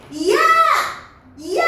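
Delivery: "이야" split into i and ya; drawn-out two-syllable call. Shown as a demonstration. Teacher mimicking a wrong way to call a friend, "이야" in two syllables. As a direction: stretch "이야" as two sounds, i then ya. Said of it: "누가 친구를 부를 때".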